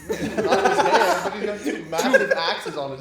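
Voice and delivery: cracking up while protesting; laughing. Real, not acted. Laughing protest about axes.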